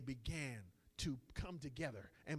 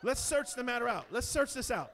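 loud, higher preaching shout; 6 semitones higher, 11 dB louder than usual. Acted. Preacher to congregation, shouting the call to search. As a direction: proclaim it to the congregation with fire.

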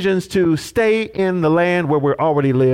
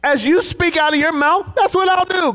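a much higher, insistent God voice; God's verdict; 11 semitones higher than usual. Retold or imitated. Preacher voicing God's sentence to murmuring people, high and firm. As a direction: pronounce it as God's ruling. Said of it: "God says".

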